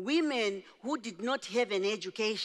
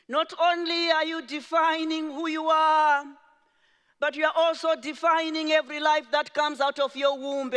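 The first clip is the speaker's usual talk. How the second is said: rising mother's exhortation; 7 semitones higher, 6 dB louder than usual. Retold or imitated. Mother's charge rising with force.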